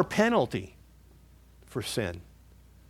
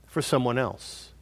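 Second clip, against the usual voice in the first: ordinary talk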